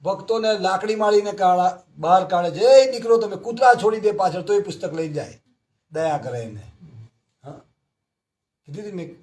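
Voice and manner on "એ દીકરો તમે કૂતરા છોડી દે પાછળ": loud, high shout at devotees; angry shout. Retold or imitated. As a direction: yell to drive them off with dogs. Householder chasing devotees away. (ગુસ્સામાં મોટેથી બૂમ)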